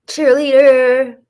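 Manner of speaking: mocking. Mocking claim she was cheerleader.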